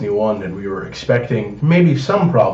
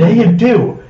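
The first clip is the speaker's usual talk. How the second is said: insistent yell; 9 semitones higher, 4 dB louder, slower than usual. Real, not acted. Friends arguing over game spending.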